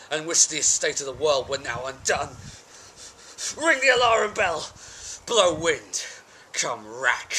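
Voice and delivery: loud, reckless battle roar; defiant shout. Acted. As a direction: hurl it out in defiance of doom. Defiant call before battle.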